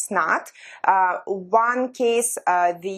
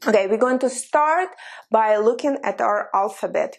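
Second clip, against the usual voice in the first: ordinary talk, nothing marked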